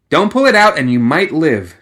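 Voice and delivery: loud urgent shout mid-fight; shouted warning. A narrator's reading. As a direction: bark the order over the gunfire noise. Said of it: "she shouted".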